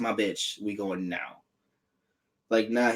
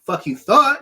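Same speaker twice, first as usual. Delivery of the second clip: sassy mock retort; 7 semitones higher, 4 dB louder than usual. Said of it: "And so Rey was like".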